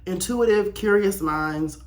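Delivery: ordinary talk, nothing marked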